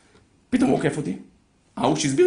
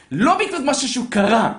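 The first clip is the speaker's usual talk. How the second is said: ordinary talk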